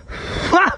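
sudden high-pitched yelp; short, high squeal of losing composure; high burst of breath, overcome; flustered outburst. Real, not acted. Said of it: "取り乱しちゃいましたけども".

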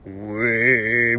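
low, sustained throat-singing drone; rough attempt at khoomei, held long; mock throat singing. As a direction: imitate a Mongolian khoomei drone. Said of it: "ホーミーってああいうことちゃうんすか？".

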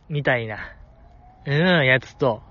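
normal talking voice

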